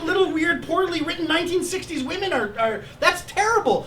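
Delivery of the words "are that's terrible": ordinary delivery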